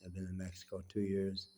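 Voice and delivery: usual speaking voice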